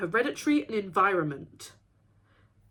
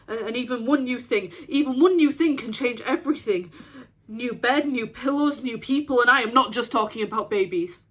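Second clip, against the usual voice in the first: louder, rising overwhelm; agitated, raised voice; mounting agitation; 3 semitones higher, 5 dB louder than usual. Acted. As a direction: build insistently, piling one item on the next. Overwhelmed by so many new things.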